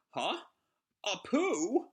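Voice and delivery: high, stunned gasp of disbelief; shocked. Acted. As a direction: react in total shock. Voicing crowd's shocked reaction.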